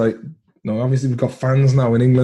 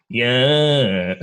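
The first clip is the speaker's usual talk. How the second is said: slow Irish character, vowels dragged out; drawn-out catchphrase and boasts in character; Viper. Acted. Comedian does his character on request, slow Viper voice. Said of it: "can you do Viper?".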